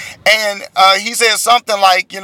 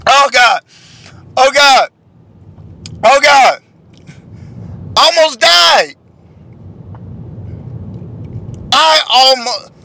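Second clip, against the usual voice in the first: recovering from choking; 6 semitones higher, slower than usual. Real, not acted. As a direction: gasp it out after a coughing fit.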